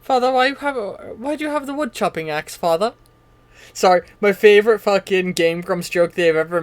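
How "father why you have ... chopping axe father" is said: high-pitched naive son voice; young boy. Acted. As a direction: ask father in an innocent child's pitch. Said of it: "the young boy who".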